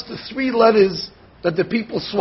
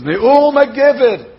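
slow, louder, drawn-out recitation; solemn verse quote; 4 semitones higher, 5 dB louder, slower than usual. Acted. Rabbi intoning a verse to his class.

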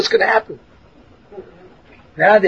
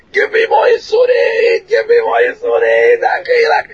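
high, slow desperate shout; screaming; 13 semitones higher, slower than usual. Retold or imitated. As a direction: beg for more at the top of the voice. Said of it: "And the guy starts screaming".

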